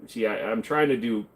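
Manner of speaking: usual speaking voice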